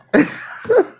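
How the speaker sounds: high giggling laugh; real giggle. Real, not acted. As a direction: break into a laugh. Laughing after scolding God.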